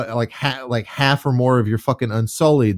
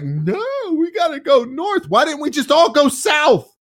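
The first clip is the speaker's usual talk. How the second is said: much higher mock voice, scoffing at the plan; incredulous mockery. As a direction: mimic the stubborn plan with disbelief. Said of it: "But you're like".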